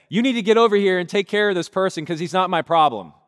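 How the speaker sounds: loud, high, fast yelling into the phone; frantic shout. Retold or imitated. Pastor begging a friend on the phone, loud and frantic. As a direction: dump it on them in a rushed panic. Panicked call demanding help.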